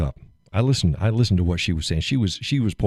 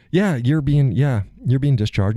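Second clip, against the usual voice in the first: higher, perky employee voice; mock cheerful staffer; 7 semitones higher than usual. Man imitating hospital staff discharging him. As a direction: chirp the news.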